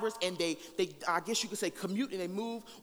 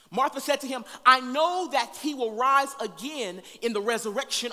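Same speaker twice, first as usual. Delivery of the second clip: preaching at full force; 5 semitones higher, 9 dB louder than usual. Acted. Preacher reading Martha's plea to the congregation, full force. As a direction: proclaim each line to the room. Preacher reads the Lazarus passage.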